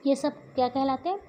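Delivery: ordinary delivery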